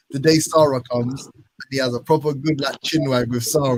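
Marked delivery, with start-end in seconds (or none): none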